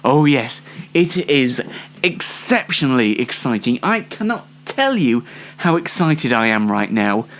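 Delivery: ordinary delivery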